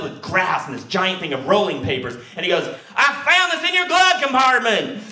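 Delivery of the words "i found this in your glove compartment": high, loud accusing patrolman; lightly voiced officer confronting the driver; stern cop.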